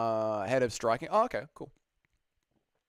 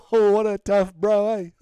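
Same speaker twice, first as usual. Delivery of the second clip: put-on loud, higher, slow New Zealand accent; Dan Hooker accent; 8 semitones higher, 10 dB louder, slower than usual. Streamer praising a tough bro, loud New Zealand accent. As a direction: bellow it like a proud Kiwi. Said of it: "Can you do a Dan Hooker New Zealand accent?".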